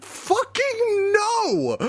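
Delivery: high, drawn-out mock outburst; Crowler. Teacher refusing the intruder's duel demand, high comic outburst. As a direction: cry out a slow, hammed-up refusal. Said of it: "and Crowler's like".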